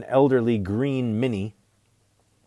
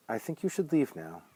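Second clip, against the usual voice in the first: hushed, higher, controlled order; cold dismissal; 4 semitones higher, 7 dB quieter than usual. A narrator's reading. Mother turning out an unwelcome guest, quietly cold. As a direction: dismiss him softly and coldly.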